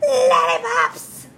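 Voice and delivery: loud, high, drawn-out shout. Acted. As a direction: yell it from the back. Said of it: "can't hear your answer".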